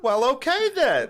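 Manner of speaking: put-on high, slow, prim old lady voice; huffy old lady. Old woman accepting apology, high huffy mock. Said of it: "She was like".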